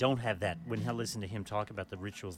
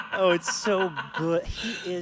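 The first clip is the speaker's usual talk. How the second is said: laughing through the words; 6 semitones higher, 4 dB louder, slower than usual. Real, not acted. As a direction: laugh while saying it. Laughing about Howard joke.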